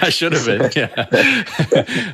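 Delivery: laughing through the words. Real, not acted. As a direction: laugh it off sheepishly. Laughing at being teased.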